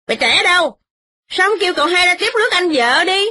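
high, mocking fast jibes; mock him with sharp, high sarcasm; sarcastic shouting. Wife sneers at the kneeling tenant, high and fast. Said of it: "mợ la rằng".

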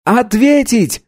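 high, eager shout; enthusiastic shout. A narrator's reading. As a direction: cheer it out eagerly. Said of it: "с энтузиазмом выкрикнул Мо".

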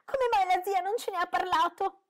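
high, loud complaining question; a spoiled girl feeling left out; indignant girl. A narrator's reading. Daughter complaining about her aunt, high and loud.

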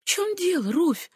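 soft, high, caring question; tender concern for her cousin; warm sympathy. A narrator's reading. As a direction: reach out to her gently. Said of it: "с тёплым сочувствием в голосе".

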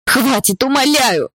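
high begging cry that breaks; pleading scream. A narrator's reading. Girl begs the guards to stop, high pleading scream. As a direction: beg them to stop, screaming. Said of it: "закричала я".

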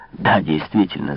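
ordinary delivery

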